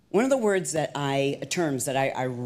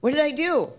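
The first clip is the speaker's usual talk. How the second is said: high-pitched mock innocent question; retold bewildered protest; 11 semitones higher, 4 dB louder than usual. Trainee answering the angry director, high bewildered protest. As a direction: act out baffled protest at being scolded.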